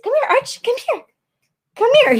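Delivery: high sing-song call to the puppy; calling the dog. Real, not acted. Owner calling her puppy over, high coaxing call.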